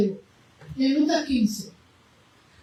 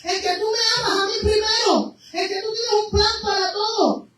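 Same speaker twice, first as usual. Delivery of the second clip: impassioned preaching; 6 semitones higher than usual. Acted. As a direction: preach it out with rising fervor. Preaching at full force. (predicación fervorosa)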